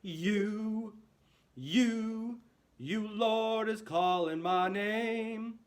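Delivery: strong, fuller singing voice; forte. Shown as a demonstration. Teacher modelling the strong forte verse. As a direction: call up to the Lord in the sky. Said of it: "this is our time to be forte".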